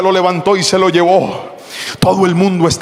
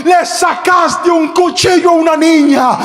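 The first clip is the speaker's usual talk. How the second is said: high, drawn-out accusing shout; indignant shout; 6 semitones higher, slower than usual. Acted. Pastor to the thief who pulled a knife, indignant shout.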